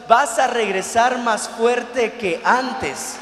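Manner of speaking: loud, stretched-out pulpit delivery; forceful preaching. Real, not acted. Preacher promising a stronger return to the congregation, forceful preaching. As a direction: drive each phrase home to the congregation.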